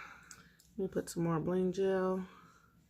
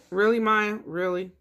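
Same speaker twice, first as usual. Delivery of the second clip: loud exasperated scolding; 3 semitones higher, 8 dB louder than usual. Real, not acted. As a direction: call out at Maya in annoyance. Scolding Maya for the noise.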